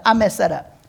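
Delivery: normal talking voice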